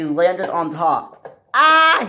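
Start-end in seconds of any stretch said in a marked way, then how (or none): 1.5-2.1 high, loud "ah" scream. Acted. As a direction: scream out in alarm. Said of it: "he screamed".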